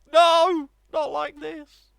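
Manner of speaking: anguished shout. Real, not acted. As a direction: howl it at the screen. Watching a goal go in.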